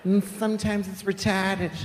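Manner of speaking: high, slow Boston schoolteacher; Boston accent teacher. Acted. Teacher adding a sad aside to sex ed, high Boston accent. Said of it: "the teachers talk like that".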